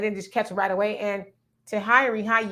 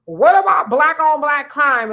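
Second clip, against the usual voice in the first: loud, higher, slow mock question; mocking retort; 6 semitones higher, 9 dB louder than usual. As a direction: throw their tired rebuttal back, hammed-up. Mocking a common rebuttal.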